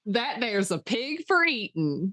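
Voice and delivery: lightly put-on country farmer drawl; folksy farmer voice. Joking with cohost, lightly voiced country farmer. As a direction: play a rustic farmer sizing up the pig. Voicing a farmer about pigs.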